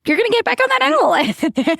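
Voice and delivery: high, insistent mom voice; firm mom. Retold or imitated. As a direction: tell them they're getting back on. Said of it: "And I'm like".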